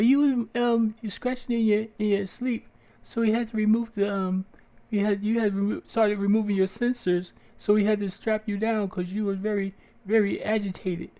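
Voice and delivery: a doctor fumbling an excuse; mock doctor. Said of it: "the doctor came and talked to me".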